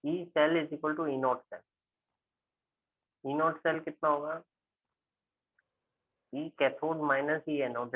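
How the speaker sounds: slow, word-by-word formula dictation; teacher dictating the cell equation slowly. Real, not acted. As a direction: spell it out slowly as you write it on the board. Dictating formula while writing.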